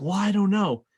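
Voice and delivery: wife shrugging off the mystery; huffy wife. Retold or imitated. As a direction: brush him off. Wife retorts to frantic husband.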